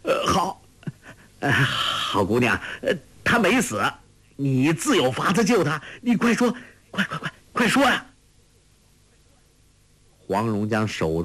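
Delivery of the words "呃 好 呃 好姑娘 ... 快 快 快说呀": high, frantic, rushing at the end; urgent pleading. A narrator's reading. Ouyang Feng begging Huang Rong to save his nephew, frantic and high. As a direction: beg her to hurry, pitch climbing. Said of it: "急道".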